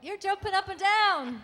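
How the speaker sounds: high, loud, amused remark; bursting with delight at the fan. Real, not acted. Actress reacts to excited fan.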